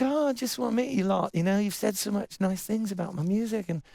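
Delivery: put-on English accent. [name] greets the young band, light English accent.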